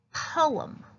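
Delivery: Poem said in two distinct parts, po-em; two clear syllables. Shown as a demonstration. Teacher stressing to the class that Poem has two syllables. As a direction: give both syllables of Poem their own beat. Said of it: "it has two syllables, not one".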